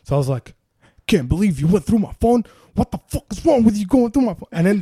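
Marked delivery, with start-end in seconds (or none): 1.1-4.5 angry retort. Retold or imitated. He yells back in car fight.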